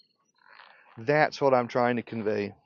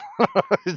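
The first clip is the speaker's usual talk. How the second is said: a sudden squeaky laugh up high; high squeal of laughter; 4 dB louder than usual. Real, not acted. Laughing at her in a high squeal. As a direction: burst out in a high giggle.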